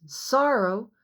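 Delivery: ordinary delivery, nothing marked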